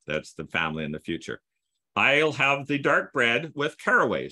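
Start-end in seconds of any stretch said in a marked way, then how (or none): none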